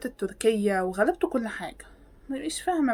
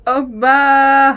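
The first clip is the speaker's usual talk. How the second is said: a loud drawn-out oopa; surprised exclamation; 3 semitones higher, 11 dB louder, much slower than usual. Real, not acted. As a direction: shout it at the reveal. Reacting to a reveal in video. (دهشة عالية)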